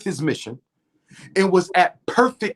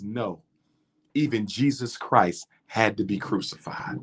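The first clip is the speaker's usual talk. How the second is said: calm repose; 7 semitones lower, 4 dB quieter than usual. Retold or imitated. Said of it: "in total calm repose".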